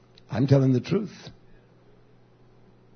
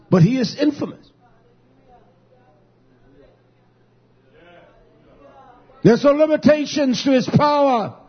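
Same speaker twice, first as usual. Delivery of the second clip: raised, building sermon delivery; preaching at full force; 5 semitones higher than usual. Acted. Preacher rousing the congregation about infinite understanding, building preaching.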